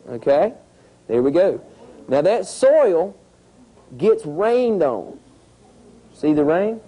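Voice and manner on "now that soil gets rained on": very slow, every word drawn out; slow emphasis. Shown as a demonstration. Teacher slowing down so the class follows, very slow. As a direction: spell out "Now that soil gets rained on" word by word. Teacher stressing the soil step.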